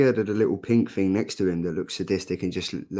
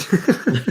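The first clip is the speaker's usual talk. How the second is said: high laugh; 9 semitones higher, 4 dB louder than usual. Real, not acted. Laughs at the joke.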